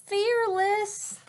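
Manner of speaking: high, slow self-reassurance; frightened girl trying to sound brave; forced bravery. Acted. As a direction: talk yourself into courage. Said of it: "tried to convince herself".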